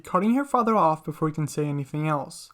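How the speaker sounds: plain reading voice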